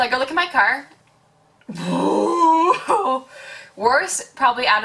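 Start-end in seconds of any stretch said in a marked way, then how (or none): 1.7-3.2 high, drawn-out crying "ooh"; long exaggerated wail of dismay; mock wail. Mock wail at wrecked car.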